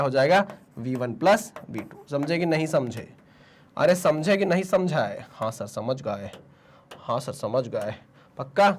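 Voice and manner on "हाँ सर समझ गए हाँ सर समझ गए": low, soft voice of obedient pupils; students answering. Acted. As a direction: answer as the class, low and subdued. Answers himself as his students. (धीमी, दबी आवाज़ में छात्रों का जवाब)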